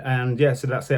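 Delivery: ordinary talk, nothing marked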